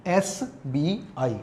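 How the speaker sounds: "एस बी आई" spelled slowly and softly; letter by letter. Shown as a demonstration. Teacher reads an abbreviation for students, slow and separate. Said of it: "ये क्या है".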